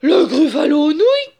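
loud, high-pitched horrified shout; gasp, then cry it out in alarm. A narrator's reading. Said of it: "cria Gruffalo". (cri aigu et horrifié)

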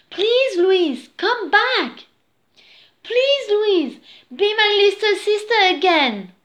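high, loud, urgent begging; wishing. A narrator's reading. Jake wishing for his little sister again, pleading. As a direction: plead for it to come true. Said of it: "he wished".

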